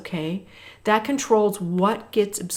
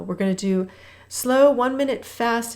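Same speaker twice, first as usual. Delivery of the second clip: usual speaking voice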